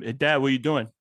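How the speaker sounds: a hint of a needy son's shout; whiny kid. Dad imitating his son pestering him, lightly shouted. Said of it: "as soon as I stand up".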